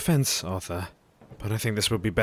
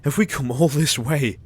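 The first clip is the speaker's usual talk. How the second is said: louder, higher anguished questions; despairing cry; 5 semitones higher, 6 dB louder than usual. Acted. Jack despairing to the group, louder and higher.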